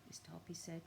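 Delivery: usual speaking voice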